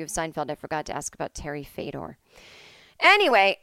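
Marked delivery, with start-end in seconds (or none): 3.0-3.6 loud exclamation. Real, not acted. Loud exasperated reset.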